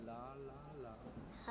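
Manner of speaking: "La, la, la" modelled softly, tongue on the ridge; soft demo syllables. Shown as a demonstration. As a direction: quietly repeat "La, la, la" for the child. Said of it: "Try it again".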